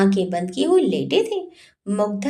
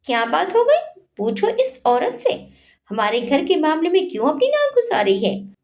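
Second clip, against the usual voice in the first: high-pitched, indignant echo of the question; angry screech; 7 semitones higher than usual. A narrator's reading. Wife complaining to husband about a meddling woman, angry screech. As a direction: throw the question back in anger. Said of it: "वो पूरे जोर से चिंघाड़ कर बोली".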